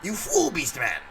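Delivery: high loud cartoon villain shout; Skeletor yelling. Skeletor yelling at his henchman Beast Man, villain shout. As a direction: screech the insult like Skeletor. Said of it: "Skeletor yelling at Beast Man".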